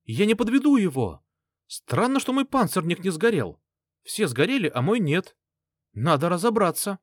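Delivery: high, excited rattling-off; high excited babbling. Acted. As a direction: gabble on like an overexcited boy. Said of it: "начал тараторить".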